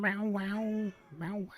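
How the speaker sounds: high meow, wow chant; sing-song meow. Pretend. Owner chanting meows while waiting for the cat, high and sing-song.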